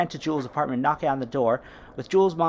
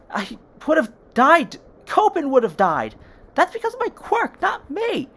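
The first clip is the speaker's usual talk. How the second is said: loud, much higher desperate yell; 11 semitones higher, 6 dB louder than usual. Acted. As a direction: shout to stop her giving up.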